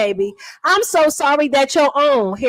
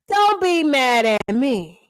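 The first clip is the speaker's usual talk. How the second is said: ordinary talk, nothing marked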